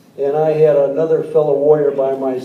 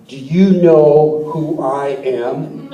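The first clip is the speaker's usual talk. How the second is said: slow, lower, imposing officer's challenge; stern admiral; 3 semitones lower, much slower than usual. Retold or imitated. Decorated admiral confronting two lost Marines, slow and imposing. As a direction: lean in and let each word land like a decorated admiral.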